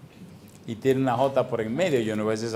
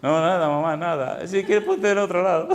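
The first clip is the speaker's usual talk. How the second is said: sheepish laughing reply; 2 semitones higher than usual. Retold or imitated.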